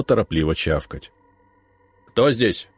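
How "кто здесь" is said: stern. A narrator's reading. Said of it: "строго окликнул я его".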